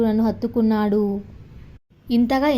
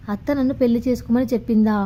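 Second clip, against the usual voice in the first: usual speaking voice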